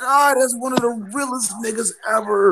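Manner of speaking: excited awe. Real, not acted. Raving about a beloved character's death.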